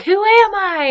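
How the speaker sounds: high, carrying call into a canyon. Retold or imitated. Slim calls out into the canyon, high and carrying.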